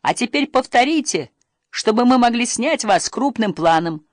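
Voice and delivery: high-pitched, lightly acted calling out; yelled instruction. A narrator's reading. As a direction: call out directions from the riverbank. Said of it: "орал Крис".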